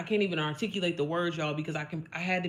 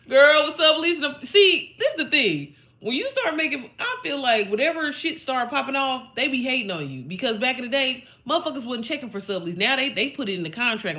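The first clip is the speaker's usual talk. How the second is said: high and loud, fired up about subleasing; excited high rant; 6 semitones higher, 6 dB louder than usual. Real, not acted. Talking to Lisa about landlords, excited loud rant.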